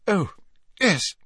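high, choked little reply. A narrator's reading. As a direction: hold back tears as you answer. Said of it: "said Hermione in a choked voice".

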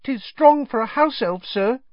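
high, squeaky house-elf voice; earnest little elf, pitched very high; Dobby. A narrator's reading. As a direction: correct him politely in a tiny elf voice. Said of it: "Dobby shook his head".

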